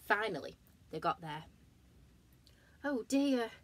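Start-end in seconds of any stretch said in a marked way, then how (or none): 2.8-3.5 worried giraffe. Acted. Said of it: "said Giraffe".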